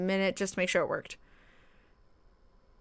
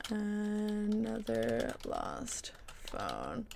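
very slow, stretched-out words, softly; dictating while typing; 9 dB quieter, much slower than usual. Real, not acted. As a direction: sound out each word slowly as you type it.

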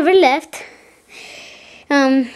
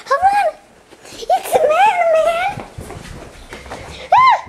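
high alarmed shout. Pretend. Sudden alarm in toy play.

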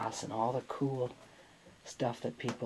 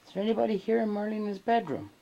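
calling out; 8 semitones higher than usual. Real, not acted. Ghost hunter addressing unseen spirits in Marlena's bedroom, calling out. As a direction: call out questioningly to whoever may be listening.

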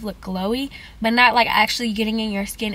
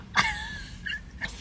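short, higher-pitched laugh; 9 dB quieter than usual. Real, not acted. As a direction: laugh at your own silly accent. Amused by own British accent.

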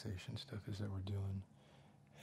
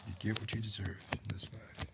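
whisper; 7 semitones higher, slower than usual. Acted. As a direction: keep it all breath, no voice. Whispered roleplay throughout.

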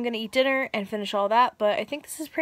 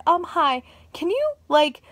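mock sweet request; 7 semitones higher, 6 dB louder, slower than usual. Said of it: "Maybe I'll be like".

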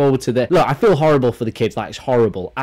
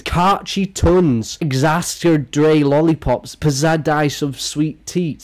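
slowly sounding out misspelled names; drawn-out mocking read-out; 2 semitones higher, much slower than usual. Shown as a demonstration. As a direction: read each silly name slowly and deliberately.

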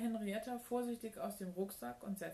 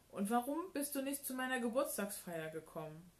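higher-pitched reproachful question; blurted out; 5 semitones higher, 3 dB louder than usual. A narrator's reading. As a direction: keep the pent-up accusation coming. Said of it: "stieß er hervor". (vorwurfsvoll hervorgestoßen)

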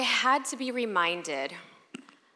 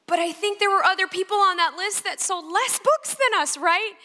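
high, loud pleading push; desperate plea; 12 semitones higher, 7 dB louder than usual. Retold or imitated. Begging her agent, high and pleading. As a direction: argue like you're clinging on.

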